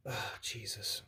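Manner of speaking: whispered under the breath, shaken; barely audible, voiceless exclamation; breathy whisper. Real, not acted. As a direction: let it out as a whispered sigh of relief. Shaken after a piece flew off.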